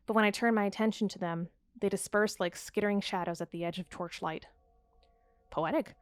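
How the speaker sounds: measured, hushed reading of a dark tale; low-key gothic story reading; story narration. A narrator's reading. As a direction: read it like an eerie journal entry. Reads the game's journal prose.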